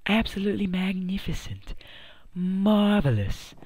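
mock grand praise. Showing viewers his kitchen, mock grand praise. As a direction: savour each word like a proud host.

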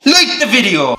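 high, loud playful order; mock command. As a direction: order the viewers in a mock shout.

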